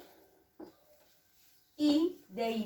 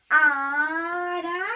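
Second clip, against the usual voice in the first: "Araña" slow, higher and louder, vowels stretched; drawn-out word; 6 semitones higher, 7 dB louder, much slower than usual. Shown as a demonstration. Teacher sounding out the word for pupils, stretched and loud. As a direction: sound out "Araña" slowly for the kids. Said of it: "teacher sounds out the word".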